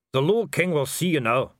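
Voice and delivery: a stiff dwarf announcing. A narrator's reading. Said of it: "said one of them".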